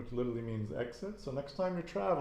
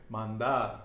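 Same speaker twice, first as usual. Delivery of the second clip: "Mandar" stressed on the last syllable "dar"; final-syllable stress; 4 dB louder than usual. Shown as a demonstration. Teacher giving infinitive example, final stress on "Mandar". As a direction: land firmly on "dar" in "Mandar". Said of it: "stress is always on the end".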